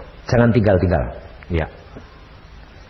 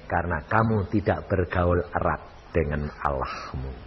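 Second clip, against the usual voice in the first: normal talking voice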